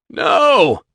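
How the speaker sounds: high, loud terrified "No!"; shout. Acted. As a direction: yell in fear at the pistol. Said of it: "Shep shouted".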